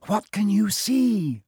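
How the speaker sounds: high, slow worried question; nervous girl peering down; anxiously. A narrator's reading. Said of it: "Hermione said anxiously".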